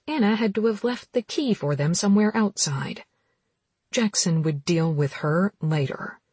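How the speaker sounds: plain reading voice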